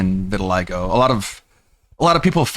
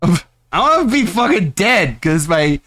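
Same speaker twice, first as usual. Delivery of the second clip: mock melodramatic gamer; 4 semitones higher than usual. Mocking gamer's despair.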